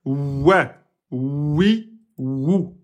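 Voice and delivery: "ouais, oui, wou" with lips rounded and pushed forward; démonstration du waw; waw demo. Shown as a demonstration. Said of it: "parce que le waou".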